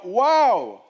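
high, loud exclamation; excited wow. Real, not acted. Preacher leads the church in a loud, amazed wow. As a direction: lead the crowd in amazement. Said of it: "Dites avec moi".

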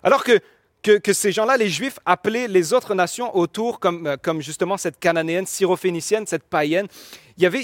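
ordinary talk

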